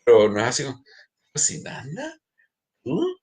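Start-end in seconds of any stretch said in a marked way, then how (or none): 1.3-3.2 incredulous, higher on the tú; hammed-up macho surprise; mock disbelief. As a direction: scoff at a man who cooks.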